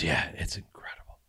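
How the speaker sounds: unvoiced confiding whisper; retold whispered praise from Clooney. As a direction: whisper it like a secret you're sharing.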